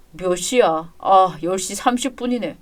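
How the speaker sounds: low, soft groggy voice; sleepy, low-pitched son; just woken up. Acted. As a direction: mumble as if barely awake. Son just woken, checks time.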